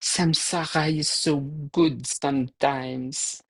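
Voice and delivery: put-on slow, dreamy French-accented "Samsara is so good sometimes". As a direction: imitate a French friend savoring dessert, slowly in a French accent. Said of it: "she would say with her French accent".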